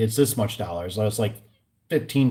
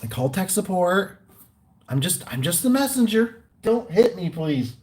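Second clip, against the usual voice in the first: higher, timid messenger plea; nervous pleading; 7 semitones higher than usual. Retold or imitated. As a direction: plead not to be blamed, higher. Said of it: "I'm like, um".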